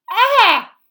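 high, very loud pained yell; scream of pain. A narrator's reading. Harry clawed by Crookshanks, screaming in pain.

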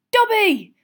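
high, loud cry of shock; startled yell. A narrator's reading. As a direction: yell the name in alarm. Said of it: "Harry yelled".

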